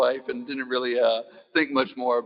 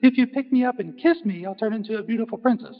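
much higher tiny voice; little frog voice; 11 semitones higher than usual. Acted.

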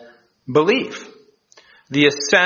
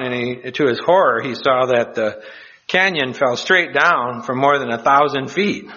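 normal talking voice

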